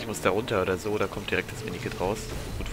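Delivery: usual speaking voice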